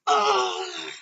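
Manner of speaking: high, loud, drawn-out "ugh"; pained grunt. Acted. Villain hit in the gut, pained cry. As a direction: cry out as if winded by a blow.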